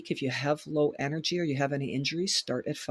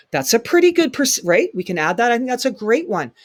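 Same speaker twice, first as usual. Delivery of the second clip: enthusiastic; 6 semitones higher, 8 dB louder than usual. Real, not acted. Enthusing about the walking plan.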